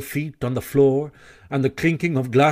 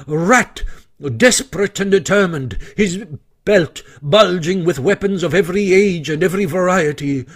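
plain reading voice

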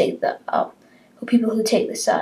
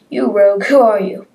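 angry challenge; 3 semitones higher, 8 dB louder than usual. Acted.

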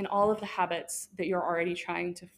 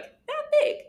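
jumps far higher on "that big" to sound tiny; tiny squeak; 3 dB louder than usual. Acted. Speaker shows how little room she gave God, squeaky and small. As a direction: squeak it out as if pinching something small.

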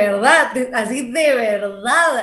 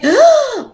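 sudden high loud "ah!" of shock; mock shocked gasp; 12 semitones higher, 8 dB louder than usual. Reading a mean comment about herself, a shocked gasp.

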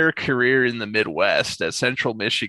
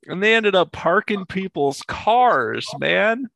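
louder and higher, bursting with amusement; excited disbelief; 7 semitones higher, 4 dB louder than usual. Real, not acted. Telling friends the hired-help punchline, excited and loud. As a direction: voice climbing high and loud as the story's punchline lands. Laughing retelling of absurd story.

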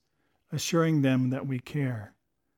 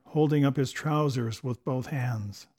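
ordinary delivery

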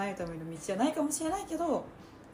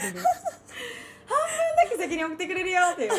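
giggling through the words; laughing while talking; 9 semitones higher, 3 dB louder than usual. Real, not acted. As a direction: laugh as you explain. Joking about the title.

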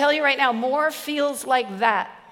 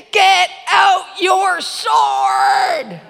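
slow, loud and higher, commanding; booming heavenly voice; 7 semitones higher, 7 dB louder, much slower than usual. Retold or imitated. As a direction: boom it out like God from heaven. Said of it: "I heard a voice from heaven".

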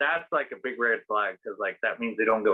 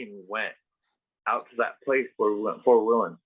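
ordinary talk

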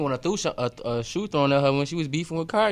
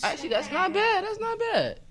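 much higher voice; high squeaky approval. Real, not acted. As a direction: squeak it with surprise. Surprised approval.

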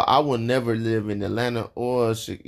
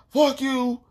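much higher, squealed fuck you; 1 mock southerner; 12 semitones higher than usual. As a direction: toss it off in a high squeak. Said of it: "y'all be like".

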